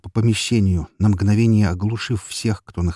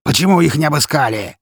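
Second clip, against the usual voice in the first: high, loud demanding shout; angry boss shout; 7 semitones higher, 6 dB louder than usual. A narrator's reading. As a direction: bark at the thugs. Boss yells at his men.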